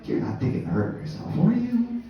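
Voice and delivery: hushed then high worried voice; overly concerned friends. Retold or imitated. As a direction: fuss over him anxiously. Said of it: "They're like".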